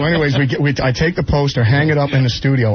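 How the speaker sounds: ordinary talk, nothing marked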